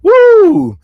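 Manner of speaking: very high, loud whoop; piercing hype whoop; excited whoop. Real, not acted. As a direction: let out a big woo mid-song. Whoop in own song.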